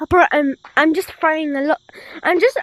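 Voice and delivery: ordinary talk, nothing marked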